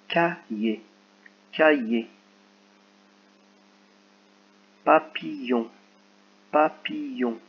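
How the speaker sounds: each word said twice, careful and distinct; clear dictation. Shown as a demonstration. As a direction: read it twice so they can tick the syllable. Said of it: "Donc c'est parti".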